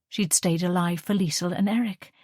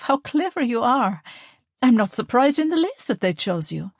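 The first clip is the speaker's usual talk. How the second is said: higher, louder, glowing praise; proud mother; 6 semitones higher, 3 dB louder than usual. Acted. Mother praising her daughter's debating win, proud. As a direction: beam with pride at your daughter.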